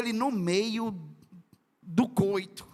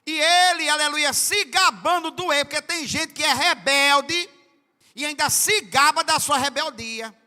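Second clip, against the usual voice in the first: loud, high preaching; fiery preaching; 8 semitones higher, 7 dB louder than usual. Real, not acted. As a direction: drive the point home with force. Preaching at full force. (pregação inflamada, voz alta)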